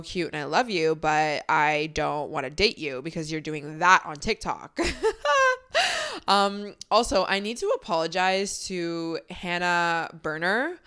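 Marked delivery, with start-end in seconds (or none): 4.8-6.2 a high, squealing burst of laughter. Real, not acted. Cracking up at her own joke about TikTok guys, high squealing laugh.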